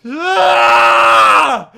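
long, loud high scream; frustrated full-throated "ah"; anguished scream. Real, not acted. Player killed in a game, anguished scream.

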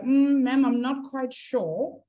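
high, drawn-out hesitant student voice; unsure pupil. Acted. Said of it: "you're still kind of like".

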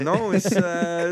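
high, mock Italian-American accent. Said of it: "in an Italian accent".

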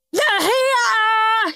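put-on high, whooping child's shout; excited shout. A narrator's reading. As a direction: shout it out in glee at the doorbell. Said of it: "shouted horrid Henry".

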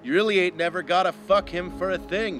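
usual speaking voice

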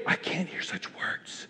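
barely audible, scandalized whisper; horrified whisper. Acted. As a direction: whisper it as a shocked inner voice.

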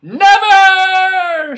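loud high cry; cried out. A narrator's reading. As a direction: fling out the word. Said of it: "she cried".

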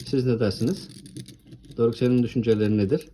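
normal talking voice

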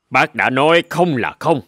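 put-on an old sheep losing patience; stern old sheep. Old sheep warning the pestering goat kid, stern.

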